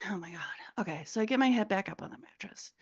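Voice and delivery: fast unvoiced whisper; quick whisper. Real, not acted. Telling the hosts about getting her head back on the mattress, in a fast whisper. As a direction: rush it out under your breath.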